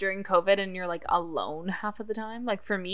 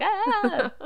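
high excited squeal; excited yelp; 12 semitones higher than usual. Retold or imitated. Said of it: "we're both like".

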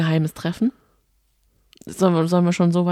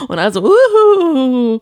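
high, loud mock goofy laugh trailing into hooting; imitating a dopey laugh; 8 semitones higher, 9 dB louder than usual. Podcaster mimics the trio's silly episode-ending laugh, high and loud. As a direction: ham up a half-witted chuckle. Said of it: "grenzdebilen Lachen".

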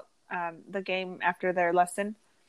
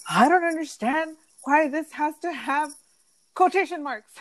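put-on higher-pitched whiny kid voice; frustrated son; 7 semitones higher, 3 dB louder than usual. Son complaining during English class, higher whiny imitation. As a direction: imitate a kid fed up with homework. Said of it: "like, freaking out".

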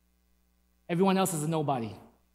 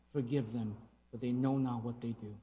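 softly, much lower and quieter; hushed words of Jesus; 9 semitones lower, 14 dB quieter than usual. Acted. Preacher quietly voicing Jesus on the cross, hushed. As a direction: hush it like a dying prayer.

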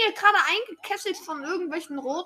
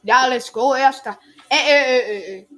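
excited cheering; 10 dB louder than usual. Real, not acted. Takes first place in race.

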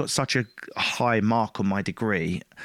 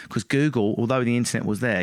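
normal talking voice

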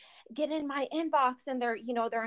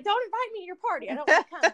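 mock refusal; 5 semitones higher, 5 dB louder, faster than usual. Mock refusal of party invite.

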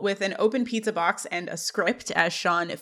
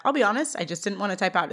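ordinary talk

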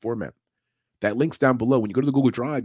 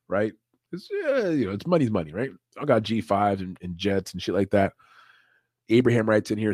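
ordinary talk

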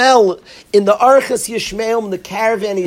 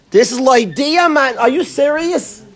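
ordinary talk

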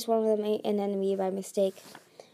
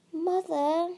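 high little whine; tiny whining voice; 8 semitones higher than usual. A narrator's reading. Little dragonet behind the queen, whining for mother. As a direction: whine like a small dragonet wanting attention. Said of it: "whined a tiny voice".

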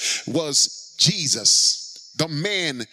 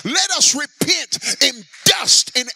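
loud, high, crying out in prayer; fervent prayer; 7 semitones higher, 3 dB louder than usual. Real, not acted. Preacher crying out to God for the church. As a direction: plead with God for repentance.

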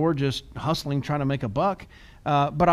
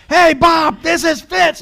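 much higher and much louder, shouted greeting; yelling into the phone; 12 semitones higher, 11 dB louder, slower than usual. Retold or imitated. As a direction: bellow the greeting at a stranger on the phone. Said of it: "I was literally yelling at people on the phone".

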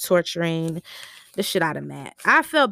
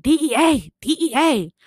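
calling out; 6 semitones higher than usual. Retold or imitated. Lorena calling out the raid.

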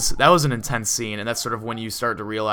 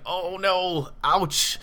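a higher, wincing little "oh no, ouch"; pained reaction; 7 semitones higher, much slower than usual. Retold or imitated. Recalling a horror scene to her co-host, mock wince. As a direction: flinch at the gross image, voice lifting. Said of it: "I was just like".

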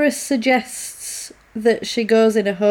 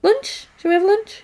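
odd sing-song offer; 9 semitones higher than usual. Retold or imitated. Deborah acting oddly cheerful.

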